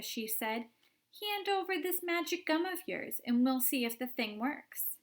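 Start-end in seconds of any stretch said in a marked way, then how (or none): 1.2-5.0 put-on bossy girl. Violet demanding gum.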